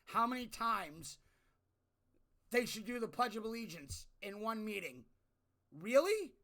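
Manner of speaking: loud, incredulous rant at Congress; fed-up yell at lawmakers; 1 exasperated rant. Retold or imitated. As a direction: vent like you can't believe it. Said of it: "'Cause I'm like".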